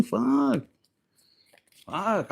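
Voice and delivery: normal talking voice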